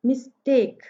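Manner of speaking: "Mistake" in two separate beats, मिस, टेक; split into syllables. Shown as a demonstration. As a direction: break "mistake" after मिस. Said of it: "miss and take".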